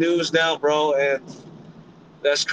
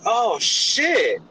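excited; 5 semitones higher, slower than usual. Retold or imitated. Reacting to seeing a famous rapper.

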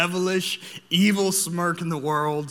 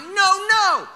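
sharp, much higher, louder no no; alarmed dad yell; 14 semitones higher, 5 dB louder than usual. Retold or imitated. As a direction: catch him as he stands, alarmed. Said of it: "I'm like".

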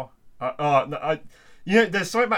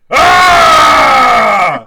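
huge booming roar at full lungs; Brian Blessed roar; 13 semitones higher, 20 dB louder than usual. Acted. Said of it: "I can do that".

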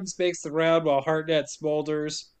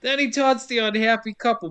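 ordinary talk

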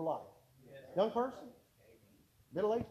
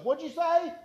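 higher and louder, yelling to be heard; shouting over noise; 9 semitones higher, 6 dB louder than usual. Retold or imitated. Guard under the alarm shouting back at the preacher.

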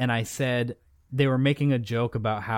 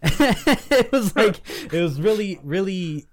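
laughing; 8 semitones higher, 7 dB louder, slower than usual. Real, not acted. Laughs about the funny moment.

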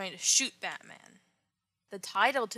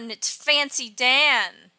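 ordinary talk

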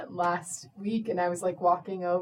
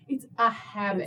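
normal talking voice

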